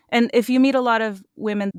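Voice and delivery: usual speaking voice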